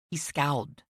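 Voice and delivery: usual speaking voice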